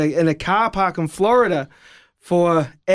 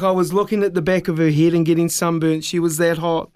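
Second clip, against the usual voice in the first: ordinary talk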